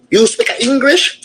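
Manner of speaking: ordinary delivery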